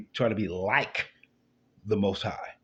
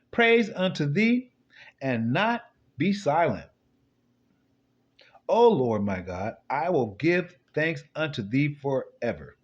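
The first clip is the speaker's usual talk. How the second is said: slow, solemn psalm reading, slightly raised; scripture reading; 4 semitones higher, slower than usual. Acted. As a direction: read the psalm like a preacher. Reads psalm aloud.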